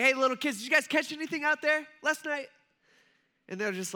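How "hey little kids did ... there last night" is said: high-pitched hollered question; loud, cheery-stranger shout, pitched way up; yelling out. Acted. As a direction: call out across the water to a boat. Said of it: "who starts yelling out to him".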